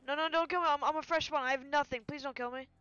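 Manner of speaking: loud, high, rapid-fire plea; urgent pleading. Real, not acted. Fresh spawn begging an armed player not to shoot, urgent pleading.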